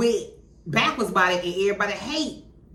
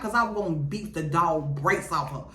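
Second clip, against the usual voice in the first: normal talking voice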